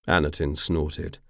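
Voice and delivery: ordinary delivery, nothing marked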